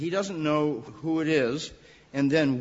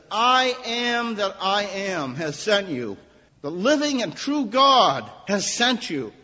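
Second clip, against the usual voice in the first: louder, higher, slow proclamation; proclaiming God's words; 5 semitones higher, 6 dB louder than usual. Acted. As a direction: announce it like a divine decree. Quoting God to Moses.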